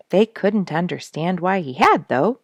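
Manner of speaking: ordinary delivery, nothing marked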